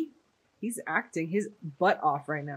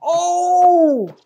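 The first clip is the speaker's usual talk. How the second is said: sudden loud, high gasp of shock; shocked cry; 11 semitones higher, 13 dB louder than usual. Real, not acted. Viewer reacting to the on-screen stabbing, shocked cry.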